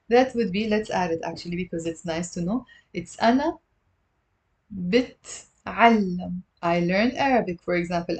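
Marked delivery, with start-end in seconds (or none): 4.7-6.4 بتعلم said slowly, in three syllables; slow model word. Shown as a demonstration. Teacher modelling three syllables, slow and clear. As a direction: draw out بتعلم slowly for the class. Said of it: "It's three syllables".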